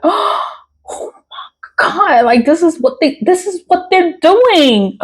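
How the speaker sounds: high, gasping mock shock; shocked realization.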